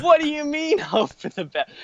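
high-pitched, laughing disbelief; acted-out incredulity, starting very high; incredulous retort. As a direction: squeal the question back in mock outrage. Throws friend's advice back, incredulous.

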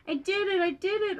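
high, excited storybook exclamation; thrilled little victory cry; gasped triumph. A narrator's reading. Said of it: "Ord gasped".

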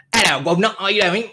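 Cockney accent. Shown as a demonstration. Host showing the co-host what poorer London sounds like, Cockney accent. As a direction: put on a jokey Cockney accent. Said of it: "that's a Cockney accent".

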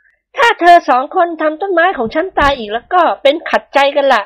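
high voice with a hint of annoyance; high-voiced mistress, mildly vexed; mildly cross lady. A narrator's reading. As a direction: scold the boys lightly over the rose. Thada annoyed over rose.

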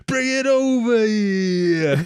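high, slow, laughing De Niro impression; De Niro steak line. Mocking De Niro's hammy line to a co-host, high and drawn out. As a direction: stretch it out high and silly, laughing. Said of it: "he's talking about bring his steak".

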